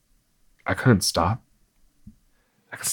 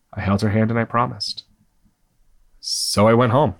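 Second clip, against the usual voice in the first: ordinary delivery, nothing marked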